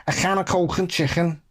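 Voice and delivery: Scouse accent demo. Shown as a demonstration. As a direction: show off a Liverpool accent from the throat. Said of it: "how to do a Scouse accent".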